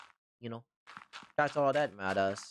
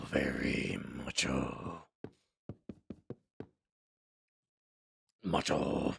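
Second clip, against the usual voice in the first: much lower, slow monster growl of Venom; 12 semitones lower, 4 dB quieter, slower than usual. Pretend. Trying a macho voice for chat, low Venom rumble. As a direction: go deep and menacing like Venom. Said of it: "if I just do a Venom voice".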